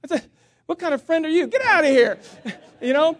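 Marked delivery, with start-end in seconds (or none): 0.7-2.2 higher, louder, indignant retort; mock outrage. Telling off the friend offering a match, indignant. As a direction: send him packing in mock outrage.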